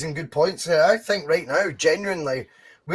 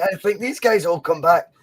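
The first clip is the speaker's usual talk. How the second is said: normal talking voice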